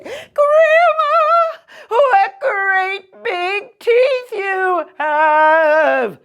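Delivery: put-on very high, slow, whimpering child; a child in terror; very high squealing girl, drawn out; terrified girl. Girl's terrified line.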